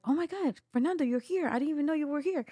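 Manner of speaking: high, bright surprise at seeing Fernando; surprised greeting. Retold or imitated. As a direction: gush like you just spotted a friend. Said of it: "she's like".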